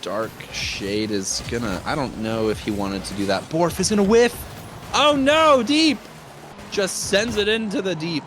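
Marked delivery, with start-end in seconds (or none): 3.4-8.2 high, loud cry of dismay at a blunder; excited exclamation. Real, not acted. Caster reacting to a whiff in front of goal, excited. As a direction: jump up high as the play goes wrong.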